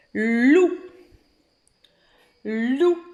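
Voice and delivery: "loup" drawn out as "lllou" with rising end; dictated syllable. Shown as a demonstration. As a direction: dictate "loup" stretched, holding the L. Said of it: "on dit une syllabe à l'oral". (« loup » dicté, L prolongé)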